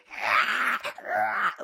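high squeal, like a squirrel on helium; exaggerated YouTube-voice screech. Vlogger mimicking own YouTube voice, high squeal. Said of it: "on my YouTube channel, I'm be like".

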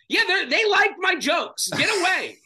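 loud, high, exasperated brush-off; exasperated shout. Retold or imitated. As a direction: shout it out, fed up. Exasperated at mom at show.

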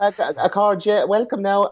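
high, jolly stage-Irish lilt; mimicking Michael D. Higgins greeting everybody in a high Irish voice; Irish president voice. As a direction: play up a super-duper Irish president greeting the crowd. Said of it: "And he talks like this".

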